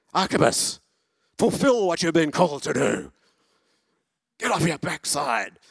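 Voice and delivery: loud, high, stern rebuke of a lazy man; harsh rebuke. Pretend. Preacher mocking a harsh reading, stern shout. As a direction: scold him to get moving. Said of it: "read this in harsh evangelical eyes".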